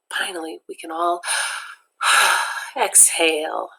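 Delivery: usual speaking voice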